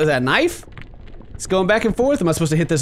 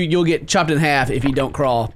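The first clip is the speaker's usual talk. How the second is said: ordinary talk, nothing marked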